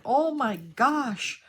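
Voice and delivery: recounting her panic at the alarm, half laughing; alarmed exclamation. Retold or imitated. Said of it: "I'm like".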